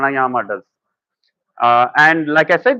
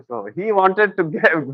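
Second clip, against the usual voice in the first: ordinary talk, nothing marked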